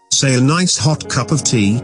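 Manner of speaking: ordinary talk, nothing marked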